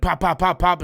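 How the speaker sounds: loud, punchy run of pops; imitated engine popping. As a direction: pop it out like a misfiring engine. Imitates the car sputtering.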